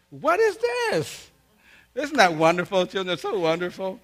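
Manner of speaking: high, loud, drawn-out disbelief; mock outrage. Dad finds the party video in the camera, high mock-outraged cry.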